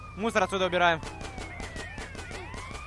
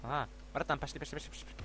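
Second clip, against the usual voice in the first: quiet urging; 9 semitones lower, 18 dB quieter, much faster than usual. Real, not acted. Urging quietly, fading away.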